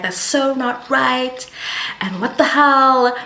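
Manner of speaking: high, drawn-out indignation of friends; mock-outraged, high and stretched; imitated outrage. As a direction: voice indignant friends rallying round. Friends' outrage acted in retelling.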